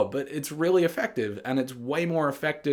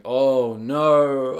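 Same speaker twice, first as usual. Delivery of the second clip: dread; 6 dB louder than usual. Retold or imitated. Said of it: "and you think".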